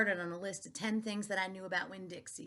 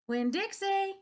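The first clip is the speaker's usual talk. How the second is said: high, loud shout of the name; 12 semitones higher, 6 dB louder, slower than usual. A narrator's reading. Preacher shouting for the lost dog, high and loud. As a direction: call the dog at full voice. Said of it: "the preacher shouted".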